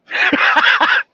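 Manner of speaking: short breathy laugh at his own story; real laugh. Real, not acted. Telling a prank story to a friend, a breathy laugh. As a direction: let a laugh burst out, no words.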